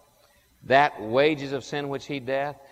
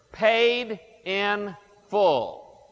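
paid in full, drawn out; 4 semitones higher, much slower than usual. Acted. Preacher to congregation, slowly spelling out the note. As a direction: stretch each word slowly, "Paid... in... full". Reading the debt note aloud.

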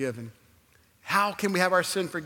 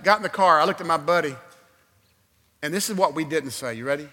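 ordinary talk

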